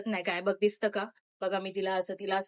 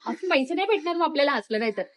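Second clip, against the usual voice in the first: higher, louder mock scolding; 6 semitones higher, 4 dB louder than usual. Older sister scolding the younger for laughing, half-mock. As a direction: tell her off for spoiling the take.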